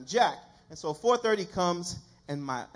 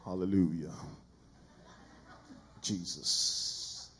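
very low, drawn-out put-on voice; mock deep voice; 10 semitones lower, 3 dB quieter, slower than usual. High-voiced preacher testing the mic, mock deep voice. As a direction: drop it right down, slow and solemn. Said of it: "I have a high-pitched voice".

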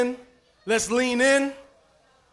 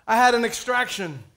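usual speaking voice

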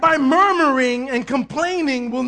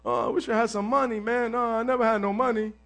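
softer, quicker mock whimper; mock crying; 3 semitones lower, 7 dB quieter than usual. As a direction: whine it to get pity. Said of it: "when you cry in front of somebody".

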